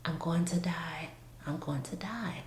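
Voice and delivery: breath-only whisper, fading; faint fearful whisper. Retold or imitated. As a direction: whisper it as if strength is running out. Recalls nearly dying, unable to scream.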